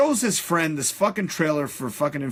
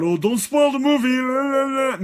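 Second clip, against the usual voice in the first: mocking quote; 8 semitones higher, 4 dB louder, slower than usual. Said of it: "everybody keeps on talking about".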